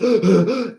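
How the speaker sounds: imitated gasps for breath; mock breathless panting; stressed gasping. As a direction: gasp like a stressed old man. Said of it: "gets stressed".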